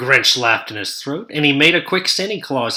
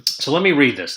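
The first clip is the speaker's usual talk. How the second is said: ordinary delivery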